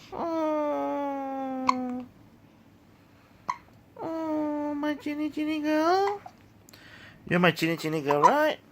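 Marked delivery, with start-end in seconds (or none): 0.1-6.2 high, soft, drawn-out coo; tender cooing. Real, not acted. Parent adoring their chinny girl, high soft cooing.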